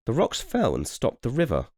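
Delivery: high, light little bird voice; a chirpy bird telling the news. A narrator's reading. Narrator voicing a bird, high and light.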